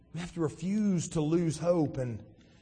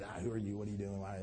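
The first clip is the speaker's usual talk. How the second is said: soft, low mock interrogation; peppering questions; 9 semitones lower, 13 dB quieter, faster than usual. Pilate questioning a silent Jesus, lightly acted peppering. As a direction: rattle off questions under the breath. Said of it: "peppering him with questions".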